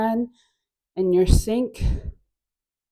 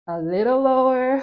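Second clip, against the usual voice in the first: called out louder and higher; louder cue; 5 semitones higher, 5 dB louder than usual. Shown as a demonstration.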